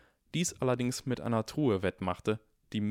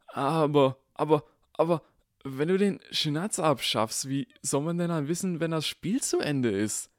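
high, stammering dismay; panicking over losing the Snitch; frightened Ron; 7 semitones higher, 3 dB louder than usual. A narrator's reading. Ron pleads with Harry, alarmed and stammering. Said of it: "Große Furcht zeichnete sich".

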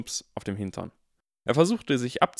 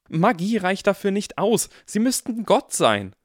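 agitated outburst; 9 semitones higher, 6 dB louder than usual. Acted. Harry's outburst at McGonagall.

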